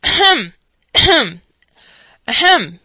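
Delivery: loud, repeated ahem; loud pompous ahem; pompous throat clearing. A narrator's reading. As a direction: clear your throat for attention. Orator clears throat before speech.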